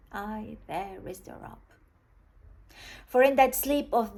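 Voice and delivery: measured recital with long pauses, swelling and falling away; dramatic soliloquy recitation. Acted. Recites Hamlet's soliloquy in English. (récitation théâtrale d'un monologue, ponctuée de silences)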